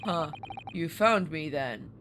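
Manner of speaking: low, slow delivery in the sailor's voice; low, drawn-out character voice; wrong character's voice. Acted. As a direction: read the hero's line as the sailor. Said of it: "Oh, wait, no, that's Ryu".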